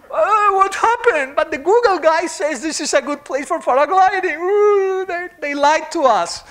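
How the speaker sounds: high, loud, hammed-up shock at the fall; mock dismay. Paraglider who just hit the ground, hammed-up high dismay.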